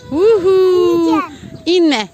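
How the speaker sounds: high-pitched woo-hoo; playful whoop. Real, not acted. Parent cheering a child on a spinning ride, playful high whoop.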